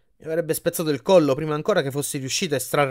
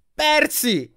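high-pitched shriek; 13 semitones higher, 4 dB louder than usual. A narrator's reading. Annabeth tied to the bed calls Percy, shrieking. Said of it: "strillò Annabeth".

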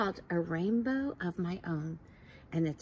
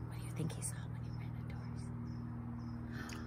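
whisper; 8 dB quieter than usual. Real, not acted. Teacher asks the children, whispering. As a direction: whisper the question to draw them in.

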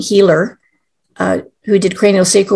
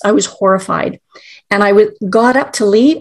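ordinary talk, nothing marked

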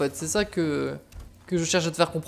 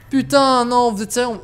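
exasperated; 5 semitones higher, 5 dB louder than usual. Real, not acted. Dies again, outraged.

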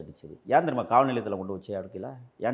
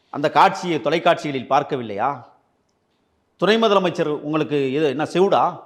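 louder and higher, scornful taunt; angry taunt; 4 semitones higher, 6 dB louder than usual. Real, not acted. Politician berating the deputy chief minister, angry taunt. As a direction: jab at him as if he were deaf.

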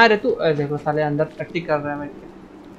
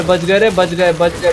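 ordinary delivery

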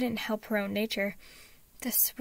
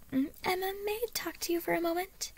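high sing-song politeness; sweetly; 9 semitones higher, faster than usual. A narrator's reading. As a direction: put on sweetness to hide alarm from a child. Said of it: "Harriet asked sweetly".

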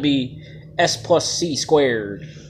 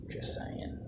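low, creepy whisper; 11 dB quieter than usual. Pretend. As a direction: whisper it like a spooky aside. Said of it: "lower my voice like that and sound all extra creepy".

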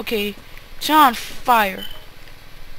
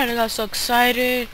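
normal talking voice

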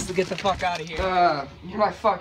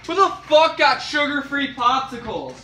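angry outburst; 7 semitones higher, 6 dB louder than usual. Acted. Finds unwanted popsicles in fridge.

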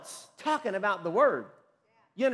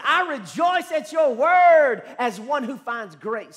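loud, raised, drawn-out cry of rejoicing; jubilant preaching; 5 semitones higher, 10 dB louder, slower than usual. Real, not acted. Preacher shares the psalmist's joy in God's word, jubilant and loud. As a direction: belt the verse out joyfully, stretching the key words.

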